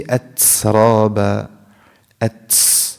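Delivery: أترابا with ت hissing into S, "atsraba"; wrong seen sound. Shown as a demonstration. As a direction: add an S after ت in أترابا. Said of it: "Hamis is not a seen sound".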